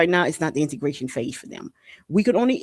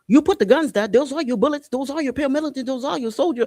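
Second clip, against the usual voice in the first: accusing; 8 semitones higher than usual. Real, not acted. Accusing foreign powers.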